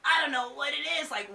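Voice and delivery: usual speaking voice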